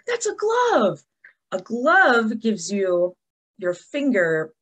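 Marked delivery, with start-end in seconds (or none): 0.1-1.0 high-pitched mimicked pupil answer; imitated eager guess. Teacher voicing her pupils' guess, high imitated answer. Voices children guessing the picture.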